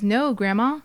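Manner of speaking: normal talking voice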